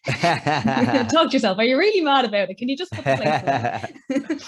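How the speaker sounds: loud, laughing telling-off; mock scolding. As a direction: playfully nag a boyfriend about the chores. Joking imagined scolding of boyfriend.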